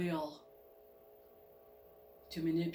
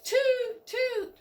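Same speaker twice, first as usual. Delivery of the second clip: very high falling toots; train whistle toot; 4 dB louder than usual. Pretend. Narrator voices the train whistle, high toots. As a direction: pipe it high like a steam whistle.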